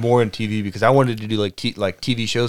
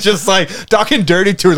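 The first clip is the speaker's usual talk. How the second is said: laughing; 8 semitones higher, 6 dB louder than usual. Real, not acted. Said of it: "Oh, dear".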